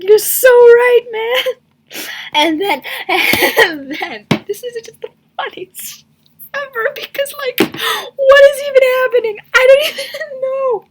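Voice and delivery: high, squealing laugh-talk, nearly tears; crying with laughter. Real, not acted. Laughing at the comic villain to her viewers, crying with laughter. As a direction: lose it laughing and squeal the words.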